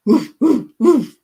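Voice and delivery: loud playful dog woofs; amateur dog imitation, loud; dog bark. Shown as a demonstration. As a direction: bark out short, punchy woofs. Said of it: "It's a dog".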